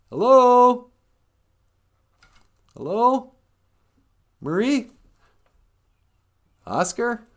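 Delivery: high searching calls, loud then easing; calling out. Pretend. Traveller searching Sesame Street for old friends, calling out. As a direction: call into an empty place, hoping for an answer.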